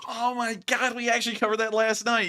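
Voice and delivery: high-pitched, eager outburst; excited recognition. Real, not acted. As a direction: burst out, thrilled to know this one. Excited at a familiar question.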